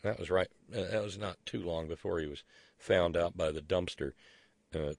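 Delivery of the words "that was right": ordinary delivery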